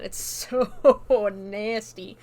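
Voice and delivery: laughing in disgust. Real, not acted. Disgusted at the scene.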